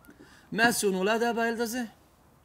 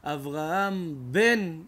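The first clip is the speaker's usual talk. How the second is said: slow reading; 5 dB louder, much slower than usual. Acted. Reading the grave inscription.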